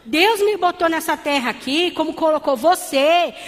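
rousing sermon climax; fervent preaching. Acted. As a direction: preach it out with fire to the church. Preaching at full force.

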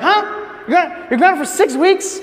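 high-pitched incredulous friend, acted out; shocked disbelief. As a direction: jump up in pitch, can't believe it.

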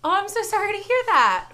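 put-on prim phone sympathy; customer service voice. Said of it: "That was [name]'s customer service voice".

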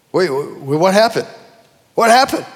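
normal talking voice

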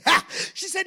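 ordinary talk, nothing marked

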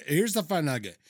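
normal talking voice

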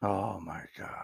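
hushed, breathless oh my God; whispered shock. Real, not acted. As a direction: whisper it in disbelief. Reacting to the grandfather story.

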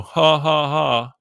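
put-on teasing laugh; imitated mocking laughter. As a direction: act out buddies laughing at someone. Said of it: "while they laugh at you and go".

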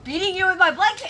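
loud, high mock attack; playful battle cry. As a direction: yell it like a charge.

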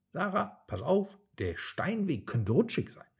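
worried little monkey warning his friend; anxious warning. A narrator's reading. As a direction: caution her, a little fearful. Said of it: "warnte Miko".

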